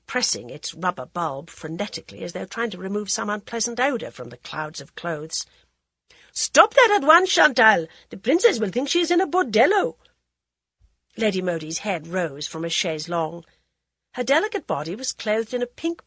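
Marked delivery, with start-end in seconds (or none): 6.3-10.0 high, loud, fast rebuke; sharp scolding shout. Acted. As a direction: shriek at the maid to stop. Lady Modi scolds the maid.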